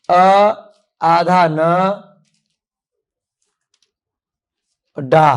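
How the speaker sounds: अ, आधा न, डा spoken separately with pauses; syllable by syllable. Shown as a demonstration. Teacher dictating अंडा sound by sound to pupils. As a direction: dictate each sound apart. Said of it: "तो यहाँ पर लिखो".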